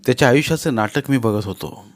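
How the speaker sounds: usual speaking voice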